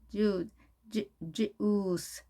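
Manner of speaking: "주스" built slowly from a repeated first sound; careful pronunciation demo. Shown as a demonstration. Teacher warning learners about this sound, careful slow "주스". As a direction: stretch "주스" piece by piece, ending on a clear s. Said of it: "이 발음 주의하셔야 되고요".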